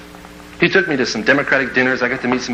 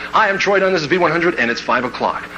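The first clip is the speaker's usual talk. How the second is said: restrained DJ station ident, name pitched up at the start; novice radio DJ; 3 semitones higher than usual. Acted. New DJ giving his name to listeners, restrained announcer read. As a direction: introduce yourself on air, measured and neat. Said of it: "now you can throw in your name".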